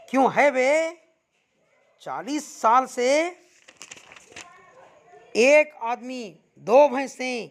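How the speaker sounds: loud, high jeering shout; loud taunting yell. A narrator's reading. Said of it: "चीखता है, चीखता है". (ऊँची आवाज़ में ताना मारती चीख)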